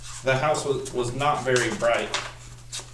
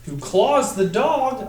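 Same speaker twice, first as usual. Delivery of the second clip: dramatic storytelling; 6 semitones higher, much slower than usual. Acted. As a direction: build the chaos for the kids word by word. Dramatic climax read to children.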